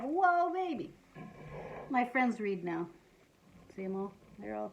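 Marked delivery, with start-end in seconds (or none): 0.0-0.9 higher, playful amazed exclamation; mock shock. Reader voicing the shocked kid in the picture, mock shock. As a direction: act lightly astonished. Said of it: "She's totally shocked".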